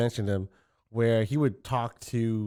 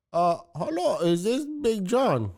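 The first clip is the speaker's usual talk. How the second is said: higher-pitched, badly faked Indian accent; 7 semitones higher than usual. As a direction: attempt a caller's Indian accent. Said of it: "went straight to the Indian accent".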